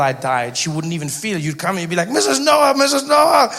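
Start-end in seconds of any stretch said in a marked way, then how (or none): 2.1-3.5 high, loud acted call to the grandmother; raised-pitch mock alarm; urgent calling out. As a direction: call out urgently with bad news. Frantic neighbour brings death news.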